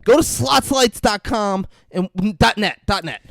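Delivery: mock ad read. Plugging a gambling site, hammed-up ad voice. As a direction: sell it like a cheesy commercial.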